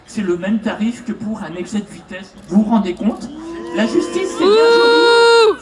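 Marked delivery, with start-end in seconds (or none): 3.8-5.6 a slow, drawn-out shout, much higher and louder; indignant shout. Real, not acted. Speaker at an open mic, outraged by a lenient sentence, shouting slowly. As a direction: bellow it out in outrage at the justice system.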